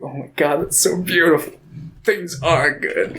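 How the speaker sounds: choked-up awe, lightly hammed; mock tearful. Faramir crying at Sam's speech, mock tearful. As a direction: pretend to be moved to tears, slowing at the end. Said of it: "Faramir's just over there crying".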